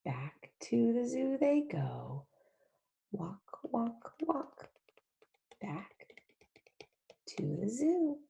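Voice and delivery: soft unvoiced storytelling whisper; hushed whisper. Teacher whispering the animals back to the zoo. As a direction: tiptoe the words like sneaking animals.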